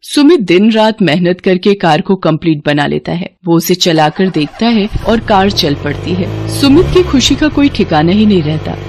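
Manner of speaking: ordinary delivery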